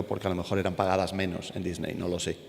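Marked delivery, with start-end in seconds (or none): none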